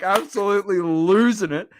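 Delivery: normal talking voice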